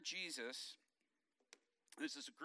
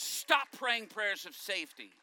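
loud, slow emphatic order; firm command; 5 semitones higher, 9 dB louder, slower than usual. Retold or imitated. Wife ordering her husband to stop, loud and slow. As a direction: lay down the law. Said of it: "She goes".

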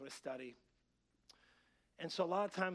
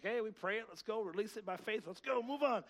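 raised, brisk hurry-along; pitched-up self-mocking impatience; impatient urging; 6 semitones higher, 5 dB louder than usual. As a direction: push everyone to get it over with. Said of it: "somebody who just feels like".

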